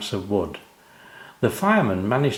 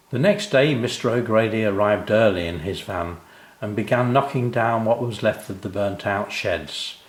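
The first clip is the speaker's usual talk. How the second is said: ordinary delivery, nothing marked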